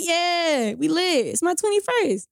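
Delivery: high-pitched birthday cheer; excited, happy. Retold or imitated. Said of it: "I'm excited. I'm happy".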